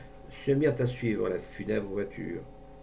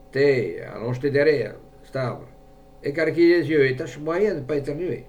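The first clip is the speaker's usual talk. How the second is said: louder, insistent poacher giving orders; commanding; 3 semitones higher, 7 dB louder than usual. A narrator's reading. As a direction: order a companion into position. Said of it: "il commanda".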